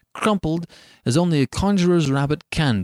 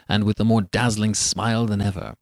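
ordinary delivery, nothing marked

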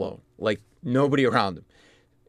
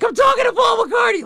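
very high, loud fangirl shriek; starstruck inner scream; 8 dB louder than usual. Retold or imitated. Screaming inside while chatting coolly with a star, giddy high shriek. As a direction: squeal it like a thrilled teenage fan. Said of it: "On the inside, I'm like".

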